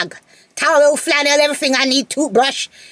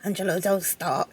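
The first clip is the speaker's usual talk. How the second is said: inner thought; 3 semitones lower, 9 dB quieter than usual. Retold or imitated. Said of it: "I was like".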